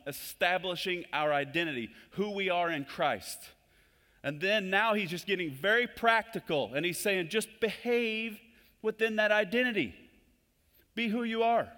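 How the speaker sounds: loud, high, driving sermon voice; preaching at full force. Acted. Preacher urging the church to live their identity, full-force preaching. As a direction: pound the point home to the congregation.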